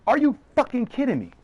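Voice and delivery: high, stunned disbelief; exasperated outburst at the absurd charge; incredulous. Retold or imitated. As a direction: throw it up high like you can't believe it. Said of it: "I'm like".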